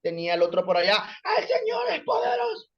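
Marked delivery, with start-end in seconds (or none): 1.4-2.7 much higher cry-shout of a zealot. Retold or imitated. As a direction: wail it at full pitch. Chaotic exorcism, another shouting. (grito agudo y lloroso)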